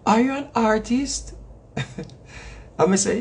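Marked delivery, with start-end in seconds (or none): 0.0-1.3 lightly voiced old lady, slow and higher; higher, careful question in a mild old-lady impression; elderly lady. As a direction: imitate a polite old woman's curious question. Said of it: "elderly lady".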